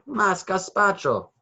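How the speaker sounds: high, slow, drawn-out plea; crying out. A narrator's reading. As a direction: stretch it out like a begging child. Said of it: "he cried".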